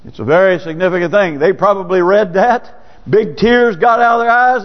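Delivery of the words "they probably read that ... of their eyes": ordinary delivery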